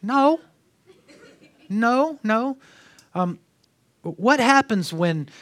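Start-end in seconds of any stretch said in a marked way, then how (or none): 0.0-2.6 high, emphatic repeated no; firm no. Real, not acted. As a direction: refuse it flatly, three times. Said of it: "I have a very easy answer".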